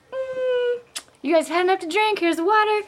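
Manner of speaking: high-pitched teasing, imitating a waitress; mock the drunk guys in a high voice. Waitress tells drunk guys they've had enough, mocking. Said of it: "totally making fun of them".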